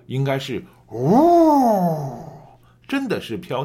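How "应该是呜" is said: a long, slow, loud howl like a blizzard; wind howl. Shown as a demonstration. Teacher correcting the student's weak imitation: slow loud wind howl. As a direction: stretch the sound out like wind over snow. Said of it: "你的模仿也不够啊，应该是".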